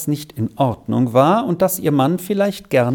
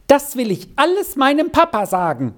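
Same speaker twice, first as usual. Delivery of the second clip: put-on a child's vehement cry, pitched higher; vehement outcry; 10 semitones higher than usual. A narrator's reading. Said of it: "rief sie heftig aus".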